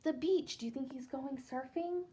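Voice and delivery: ordinary delivery, nothing marked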